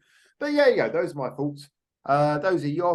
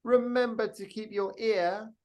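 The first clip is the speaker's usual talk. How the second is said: sign-off; 8 semitones higher, 3 dB quieter, slower than usual. Acted. Signature sign-off line.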